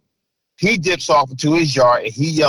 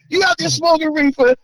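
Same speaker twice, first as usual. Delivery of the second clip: mother's accusing yell. Retold or imitated. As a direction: snap the question at him like a suspicious mother. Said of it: "my mother's yelling at him".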